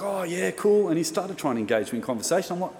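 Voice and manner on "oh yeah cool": upbeat, higher-pitched imitation of a lifesaver; light, breezy mock-casual reply; happy, friendly. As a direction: play along like a chummy lifesaver. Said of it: "friendly guy".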